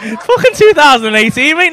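ordinary delivery

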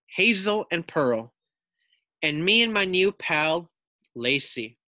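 put-on reading to children. A narrator's reading. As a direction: read it out lively to young pupils. Teacher reads picture book aloud.

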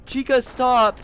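panicked yell. Real, not acted. Yelling at chasing enemy.

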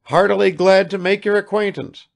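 bluff, formal welcome; father welcoming the guest; hearty greeting. Acted.

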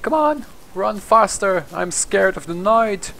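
normal talking voice

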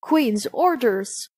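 ordinary delivery

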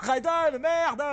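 high laughing curse; laughing outburst. Real, not acted. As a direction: cry out while laughing.

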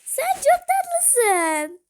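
very slow, high and loud cooing; cooing baby talk. Pretend. Gushing at the tiny cute avatar, high slow baby talk. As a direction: stretch "Sen çok tatlısın" out like talking to a baby.

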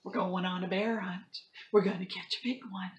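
lively storybook read-aloud; reading to children. Acted. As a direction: act the story out for kids. Teacher reads picture book to class.

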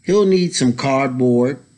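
ordinary delivery, nothing marked

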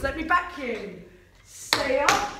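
ordinary talk

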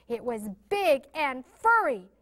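ordinary delivery

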